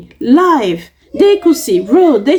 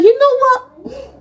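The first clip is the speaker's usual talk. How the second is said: mock high voice of the man; 12 semitones higher, slower than usual. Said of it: "and he was like".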